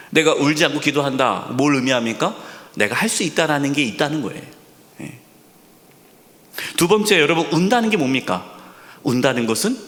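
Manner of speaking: ordinary delivery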